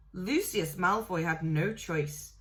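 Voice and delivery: ordinary delivery, nothing marked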